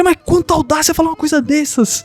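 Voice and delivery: high, scandalised voice; a lightly voiced outraged noble; offended elder. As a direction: clutch your pearls at the insult.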